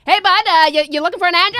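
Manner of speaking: hey bud, shouted across the lot; loud friendly call. Retold or imitated. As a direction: call out loudly, overly chummy. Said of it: "And he's yelling loud".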